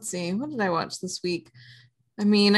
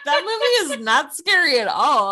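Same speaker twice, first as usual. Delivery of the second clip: higher and louder, laughing through it; laughing disbelief; 10 semitones higher, 6 dB louder than usual. Real, not acted. Complaining to co-host about a tame horror film, laughing. Mocking The Stepfather.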